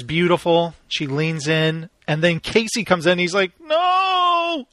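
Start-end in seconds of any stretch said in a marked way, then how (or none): 3.6-4.7 high, drawn-out woozy cry of mock disgust; drugged Chuck. Host mimicking drugged man recoiling from agent, woozy wail. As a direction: imitate someone wonky on drugs, pitched way up. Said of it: "Chuck is all wonky from the drugs".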